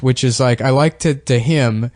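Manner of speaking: normal talking voice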